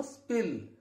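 स्पिल with a plain P after the S; unaspirated P. Shown as a demonstration. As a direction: keep the P in स्पिल dry, no puff of air. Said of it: "पै की sound पै ही आएगी".

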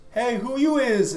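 high, slow call across the yard; kid calling out. Retold or imitated.

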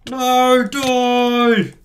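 loud high yell; battle yell. Real, not acted. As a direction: shout the kill. Yelling mid-fight.

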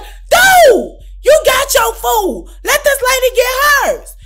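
high, loud scolding burst; telling him off. Retold or imitated. Cashier scolding a pushy customer, loud and high. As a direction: snap at him to let the lady order.

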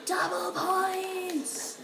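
high slow triumphant yell; excited shout. Real, not acted. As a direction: announce it like a win. Game event shout.

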